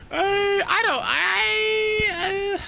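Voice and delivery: squeaky, high-pitched laughing sob; high laugh-crying. Real, not acted. Gamer breaking up at a funny costume, high laugh-crying. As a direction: overcome with laughter, words breaking up.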